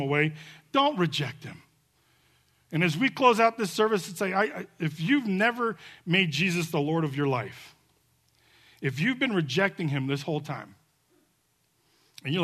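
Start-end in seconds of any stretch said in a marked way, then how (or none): none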